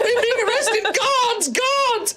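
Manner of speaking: high frantic yell for the guards; panicked shout. Acted. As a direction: cry out in alarm. Character calling for guards.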